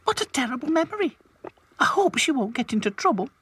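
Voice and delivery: put-on high, fast little-girl doll voice; Lucy worrying about Florrie. A narrator's reading. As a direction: fret quickly and brightly.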